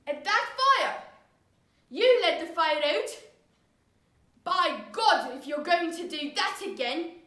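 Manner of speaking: loud, threatening outburst; furious father. Acted. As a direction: rage at the child with mounting threat. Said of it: "The man jumped up furiously".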